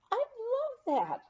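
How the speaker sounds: high, slow, thrilled burst; ecstatic. Retold or imitated. Skier thrilled at coaching, ecstatic. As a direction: let the joy lift the voice way up. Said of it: "I was ecstatic".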